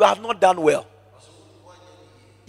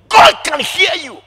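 high, loud preaching shout; loud warning; 9 dB louder than usual. Real, not acted. Preacher warning the congregation, shouting. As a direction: thunder the warning at them.

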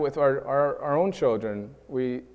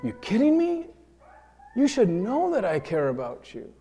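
sharp high indignant "You kidding me?"; stung and defensive, the pitch leaps up; indignant disbelief; 8 semitones higher than usual. Retold or imitated. Hurt partner answering an accusation, indignant disbelief.

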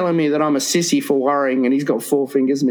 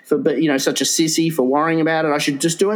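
ordinary talk, nothing marked

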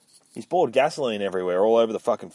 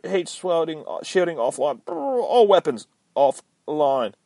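high, slow malfunctioning computer; damaged AI suit voice, stuttering status report; glitching robot voice; 9 semitones higher, slower than usual. Acted. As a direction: report damage like a failing machine.

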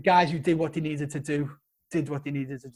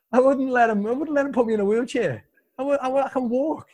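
high, loud protest with some amusement; indignant insistence; 8 semitones higher, 5 dB louder than usual. Real, not acted. Injured man refusing to be put in a wheelchair, indignant. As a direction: insist proudly, pitched well up.

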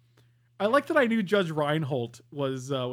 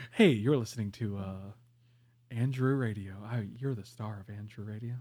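late-night jazz host; 6 semitones lower than usual. Acted. Host broadcasting to his friend from the bathtub, low jazz DJ voice. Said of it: "Frank hosting a jazz night in the bathtub".

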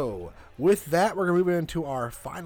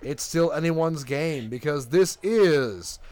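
game show host; 5 semitones lower, 4 dB quieter than usual. Acted.

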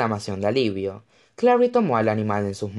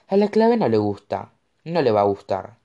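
plain reading voice

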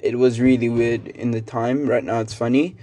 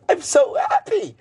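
very high, giddy squeal; silly high-pitched glee; squeaky happy voice. Real, not acted. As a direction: squeak it out like a delighted cartoon. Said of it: "I don't know why I'm speaking like this".

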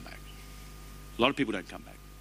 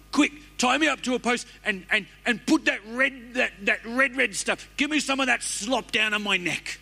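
much higher, pleading and frantic; frantic Esau; 11 semitones higher than usual. Acted. Preacher acting Esau begging for red stew, high and frantic. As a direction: gasp it out like you're dying of hunger.

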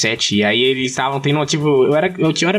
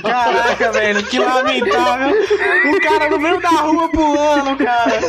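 mock pity; 10 semitones higher, slower than usual. Real, not acted. Friend mocking the jump.

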